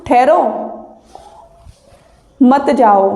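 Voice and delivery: rising ठहरो, very long pause, then मत जाओ falling; pause after ठहरो. Shown as a demonstration. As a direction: break before मत, keeping मत जाओ together. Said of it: "ऐसे कह रहा है ना". (ठहरो पर रुक कर, फिर मत जाओ)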